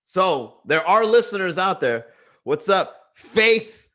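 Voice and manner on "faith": Faith called out high and loud; emphasis on faith. Real, not acted. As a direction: lean hard on Faith. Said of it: "Look at that emphasis on the word faith".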